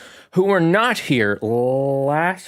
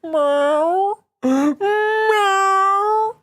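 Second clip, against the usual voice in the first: very high, drawn-out mock meow; playful cat impression; imitating the cat; 3 dB louder than usual. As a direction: squeak it out like a needy kitty. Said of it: "she's like".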